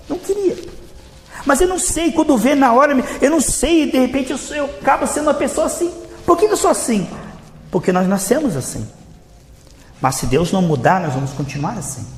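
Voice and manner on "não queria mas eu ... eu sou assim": high-pitched, helpless confession to the pastor; exasperated churchgoer. Acted. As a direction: plead in a raised pitch, baffled by yourself. Fiel aflito desabafa com o pastor. (fiel aflito, em tom agudo de desabafo)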